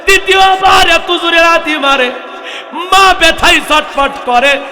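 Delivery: loud, high impassioned preaching; preacher crying out to the congregation about a mother's pain, loud and high; full-force preaching. Real, not acted. Thundering sermon, building in pitch and volume.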